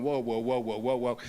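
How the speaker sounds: low, rapid string of whoas; dropped-pitch mock pilot voice; pilot calming things down. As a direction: imitate a deep-voiced man intervening. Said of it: "you can see the pilot going".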